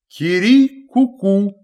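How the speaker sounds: storybook rooster call, high at first; crowing cockerel. A narrator's reading. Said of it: "и кричит". (петушиный крик, сказочно)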